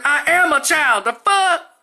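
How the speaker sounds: very high, loud outraged retort; imitated child's shrill comeback; indignant child. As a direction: yell back in disbelief like a little girl.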